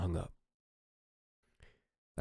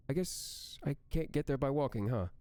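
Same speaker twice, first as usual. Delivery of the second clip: Amy's voice; 7 semitones higher than usual. Acted. Narrator voices Amy on phone.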